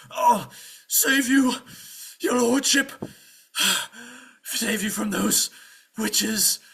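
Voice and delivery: slow, high, loud effortful squire; pathetic straining squire. Acted. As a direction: heave out each phrase while crawling to the rescue. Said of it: "he's dragging himself pathetically over to this cottage".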